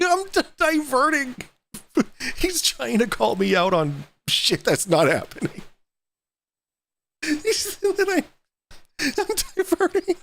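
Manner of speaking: high-pitched laughing mockery. Real, not acted. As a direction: squeal it out through laughter. Laughing, mocking a mimicked voice.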